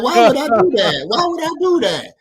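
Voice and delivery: high-pitched mock disbelief; incredulous protest. Co-host scoffing at the dude's demand, incredulous. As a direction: protest it, pitch climbing on the repeat.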